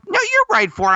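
high-pitched mock insistence; high insistent protest. Casting people insist she fits.

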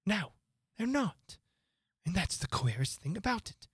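lightly voiced rabbit, mystified. A narrator's reading.

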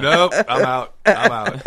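laughing high and loud. Retold or imitated. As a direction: laugh it out, backing off. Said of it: "And I'm like".

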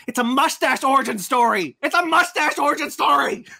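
loud, high, building outburst; incredulous shouting. Real, not acted. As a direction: holler it in comic disbelief, rising on the repeat. Exasperated punchline, repeated louder and higher.

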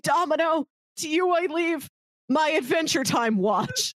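normal talking voice

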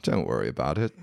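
British "darling", gently reassuring; middle-aged British woman. Retold or imitated. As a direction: put on a motherly British accent. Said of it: "a British woman, like, a middle-aged British woman".

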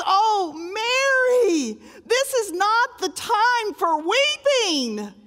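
high, slow, tender plea; pleading angels. Acted. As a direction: coax her gently from above.

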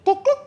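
two high-pitched boops; imitated key fob beeps. Customer hits the key fob button, imitated high beeps. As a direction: mimic a car unlock chirp, high and short.

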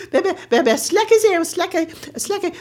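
excited calming. Retold or imitated. Mother calming children.

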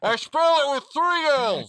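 distorted mic voice. Pretend. Host mimics his voice blasting through a hot mic, slow and loud.

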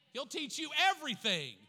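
rising preacher's climax; shouted refrain. Real, not acted. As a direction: ring it out as the payoff.

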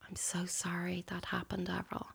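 hushed, breathy whisper of a sorry friend; soft sympathy. Retold or imitated. Friend gently consoling Avril about the abortion, whispered.

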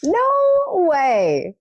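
high, loud gasp of disbelief; a real no-way of surprise; surprised disbelief. Real, not acted. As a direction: react in shocked disbelief. Hearing about soaked night sweats.

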